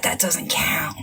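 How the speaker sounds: tossed-off whisper; whispered aside. Real, not acted. Aside while listing items.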